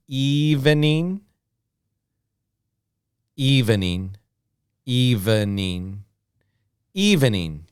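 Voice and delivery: evening in three syllables, EV-E-NING. Shown as a demonstration. Teacher models the full three-syllable form. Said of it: "we see three sounds".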